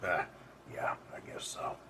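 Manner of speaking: breathy whisper, barely voiced. Jake answering the redhead about her hair, sheepish whisper. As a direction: let it slip out as a sheepish whisper.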